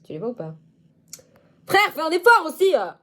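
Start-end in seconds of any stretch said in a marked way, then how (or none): 1.7-2.9 high, loud mock telling-off; high loud mock scold. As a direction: scold the viewer playfully.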